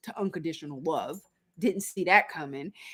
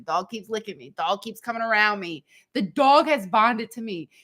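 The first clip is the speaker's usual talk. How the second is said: ordinary talk, nothing marked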